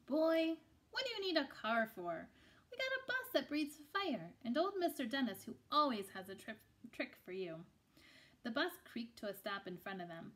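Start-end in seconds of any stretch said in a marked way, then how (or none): 0.0-7.7 louder, higher, lively grandmother; a bold grandma teasing the boy; hearty Nana, playful and big; grandma's voice. Acted. Grandma scolding then boasting.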